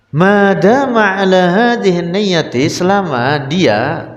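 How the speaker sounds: high, drawn-out chanting read-aloud; kitab recitation. Acted. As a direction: project the text and stretch the phrase ends.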